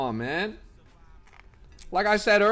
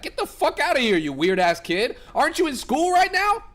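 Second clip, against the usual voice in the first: raised, high scolding; mock angry yell; 10 semitones higher, 4 dB louder than usual. Real, not acted. Streamer scolding a thirteen-year-old in chat, mock angry.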